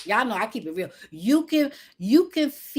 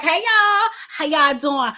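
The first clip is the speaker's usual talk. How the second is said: much higher and louder, bouncy hello; hyper greeting; 8 semitones higher, 7 dB louder than usual. Pretend. [name] showing her hyper self greeting her viewers, high and loud. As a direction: greet them like you can't sit still. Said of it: "It's like".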